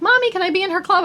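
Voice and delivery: put-on high, fast little-girl voice; child voice. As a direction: imitate an eager small child asking. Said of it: "Franny was like".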